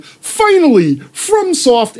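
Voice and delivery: high, gushing fanboy voice; mocking gushing fan. Gamers quoting Elden Ring with mock gushing fanboy voice. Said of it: "everyone was all like".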